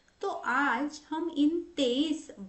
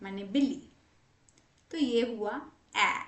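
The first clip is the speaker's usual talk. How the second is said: ordinary talk, nothing marked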